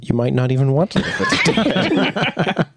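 lightly put-on Aussie voice; jokey Australian advice; Australian accent. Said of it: "I'm doing my Australian accent".

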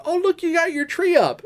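high, bright-eyed mock cheer from the wife; imitating wife. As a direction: lift it way up like a pleased girlfriend. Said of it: "And she was like".

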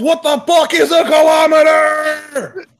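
very high, drawn-out full shout; over-the-top screamed interruption; outburst yell. Acted. Shouted outburst cutting into vote talk.

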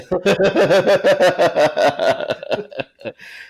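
long, loud, high-pitched laughing fit; real high cackling laughter; big laugh. Real, not acted. Loud laugh at odd beer label.

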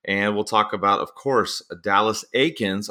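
ordinary talk, nothing marked

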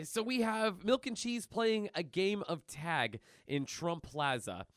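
normal talking voice

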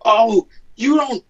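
high, drawn-out mocking imitation; put-on voice of a confrontational driver; in-your-face tow driver. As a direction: mimic a pushy tow truck guy. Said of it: "He, like, gets in my face".

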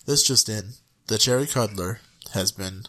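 low, serious newscaster voice; news anchor. Pretend. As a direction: deliver it like breaking news. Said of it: "More on this at eleven".

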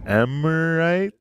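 "Emirate?" sounded out slowly as "emmer-right", rising; puzzled misreading. Retold or imitated. Host recalls first seeing the airline's name, puzzled slow misreading. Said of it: "whenever I first saw it, I was like".

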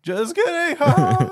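very high, loud hammed-up holler; cashier yelling. Cashier revealing his joke to the whole restaurant, imitated jolly yell. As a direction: shout the punchline with over-the-top glee. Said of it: "he was, like, yelling".

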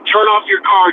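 mildly angry demand; mock yelled order. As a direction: imitate an irate man giving orders, without full volume. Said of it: "he's yelling at me".

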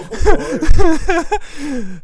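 high, loud burst of laughter; laughing hard at the joke; big laugh. Real, not acted. Friend laughs loudly at the crying joke, high burst of laughter.

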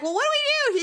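squeaky high, flustered; mock flustered self. Asking her husband at Costco, in a high flustered squeak.

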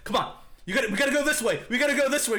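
high, loud, pressing plea; urgent urging. Retold or imitated. Film character urging the woman onward, urgent. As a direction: act out frantically hurrying someone along.

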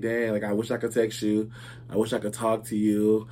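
soft, subdued grief; emotional. Real, not acted. Host recalling a friend who passed away, emotional. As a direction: speak low and quietly, missing someone. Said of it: "I'm gonna get so emotional".

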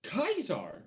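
ordinary talk

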